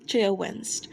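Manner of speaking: usual speaking voice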